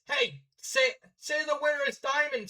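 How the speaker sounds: high, loud heckle from the crowd; woman in crowd. Acted. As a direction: call out high over a crowd. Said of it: "said a woman in the crowd".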